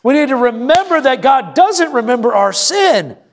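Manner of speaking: high, emphatic pulpit delivery; impassioned preaching. Real, not acted. Pastor assuring the congregation about forgiven sin, impassioned preaching.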